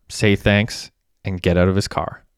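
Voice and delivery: ordinary delivery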